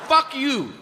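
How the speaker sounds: high-pitched blurted curse; Tourette's outburst. Acted. Kid interrupting the priest in church, high blurted curse.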